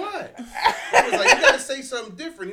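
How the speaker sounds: put-on high, loud childish outburst through laughter; mock kid protest. Father mimicking his son's classmate, high and laughing. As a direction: squeal it like a startled five-year-old. Son's friend scolds him retold.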